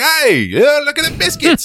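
high-pitched Cockney hawker; hammed-up Cockney street cry. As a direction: pitch it up like a Cockney biscuit peddler. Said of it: "he's gonna be a cockney".

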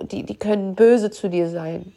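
usual speaking voice

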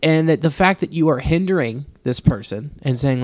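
normal talking voice

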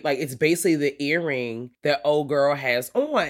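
ordinary talk, nothing marked

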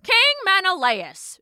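high, imperious summons falling at the end; commanding goddess. A narrator's reading. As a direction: address a mortal king as a goddess. Goddess formally addresses the kneeling king.

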